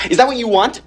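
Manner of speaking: sudden heated demand; angry challenge. Acted. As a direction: throw it at them as a threat. Threat ending the quarrel.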